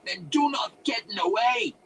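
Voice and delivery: put-on high, loud accented warning; angry shout. A narrator's reading. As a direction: shout a furious warning at intruders. Wizard screaming at intruders.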